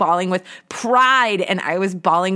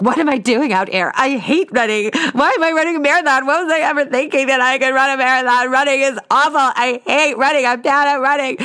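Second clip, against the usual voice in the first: higher, louder, fast whiny self-pity; despairing inner rant; 7 semitones higher, 4 dB louder than usual.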